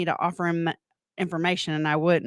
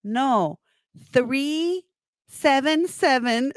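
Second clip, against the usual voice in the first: slow correction; 6 semitones higher, much slower than usual. Retold or imitated. Imitated caller correcting slowly.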